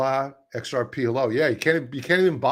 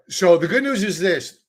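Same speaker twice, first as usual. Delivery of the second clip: mock upbeat; 5 semitones higher, 6 dB louder than usual. Mock cheerful setup for insects.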